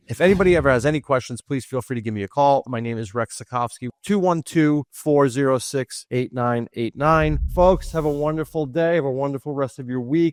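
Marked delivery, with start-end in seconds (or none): 4.0-7.4 each digit spaced and clear; slow number dictation. Real, not acted. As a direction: spell the number out slowly. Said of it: "slow dictation of phone number".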